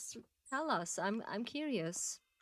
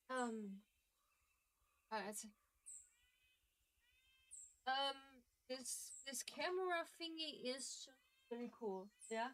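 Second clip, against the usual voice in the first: quiet; 4 semitones higher, 11 dB quieter than usual. Real, not acted. Very quiet aside to camera.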